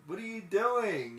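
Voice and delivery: high, slow, loud disbelief; pleading shout at Riley. Retold or imitated. Viewer yelling at Riley on screen, high and pleading. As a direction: cry out at the character in dismay. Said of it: "I was like".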